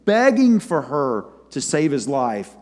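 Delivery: ordinary delivery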